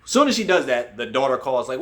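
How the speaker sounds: normal talking voice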